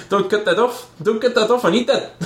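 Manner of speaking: put-on high, pleading South African accent. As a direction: panicky South African plea. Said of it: "South African accent".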